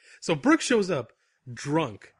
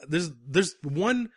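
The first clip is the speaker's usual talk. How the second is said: ordinary talk, nothing marked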